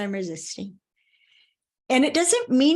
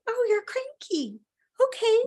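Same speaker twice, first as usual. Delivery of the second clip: retold easy acceptance; 11 semitones higher than usual. Retelling his easy acceptance of it.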